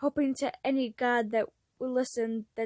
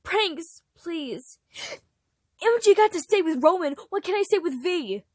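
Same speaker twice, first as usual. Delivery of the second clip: loud, higher, desperate begging; tearful pleading; 6 semitones higher, 8 dB louder than usual. Acted. Pranks begging to stay with his brother, loud and crying. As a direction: beg them to let him stay.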